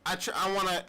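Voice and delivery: ordinary talk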